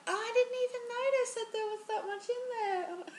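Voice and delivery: high mock Sally voice; mimicking Sally with a high exaggerated voice; Sally quoting. Friend quoting Sally in a high mocking voice. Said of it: "Sally was like".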